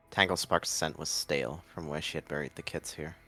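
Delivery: ordinary delivery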